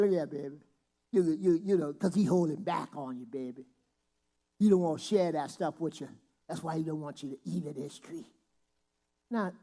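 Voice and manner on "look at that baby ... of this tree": lightly voiced smooth-talking devil; sly devil. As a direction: purr it like a snake. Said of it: "The devil said".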